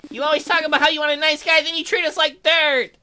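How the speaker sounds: high, loud, fast ranting bear. Acted. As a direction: rant like a bitter nice guy. Bear rants at Emily.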